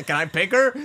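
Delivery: high, loud, through a laugh; giggled pretend husband line; laughing mock question. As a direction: tease by voicing the husband. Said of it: "listener voicing Matt, laughing".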